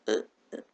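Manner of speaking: low-volume stuck grunts; grunting sounds. Shown as a demonstration. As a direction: demonstrate grunting on a stuck word. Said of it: "I make grunting sounds".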